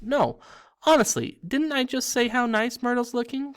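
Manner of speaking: very high, overeager insistence; insistent cover-up. A narrator's reading. Girl nudges friends to back her fib, insistently. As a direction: protest too much, pitched right up.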